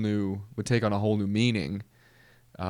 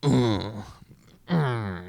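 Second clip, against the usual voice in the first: [name] grunt. Retold or imitated. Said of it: "[name] was more like".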